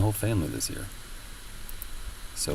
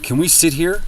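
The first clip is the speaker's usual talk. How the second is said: mimicking old couple; 9 semitones higher, 5 dB louder than usual. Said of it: "they're like".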